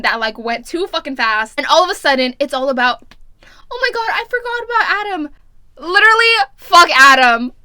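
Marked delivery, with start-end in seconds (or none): 3.7-5.3 high, quick dramatic gasp of realization; mock panic. Mocking heroine suddenly remembering boyfriend, mock panic. 5.8-7.5 very loud, emphatic dismissal; exasperated outburst. Real, not acted. Reader fed up with the rival love interest, loud exasperation. Ranting about the boyfriend.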